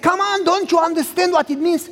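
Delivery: high and eager, can't believe he doesn't get it; exasperated son. Retold or imitated. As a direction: plead with dad.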